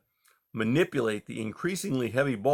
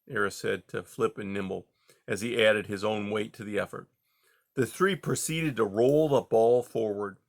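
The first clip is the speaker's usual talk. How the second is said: ordinary delivery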